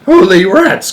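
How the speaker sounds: high, loud astonished outcry; shocked exclamation of disbelief; startled cry. Acted. Shanks sees the glass craft, crying out in shock. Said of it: "cried Shanks".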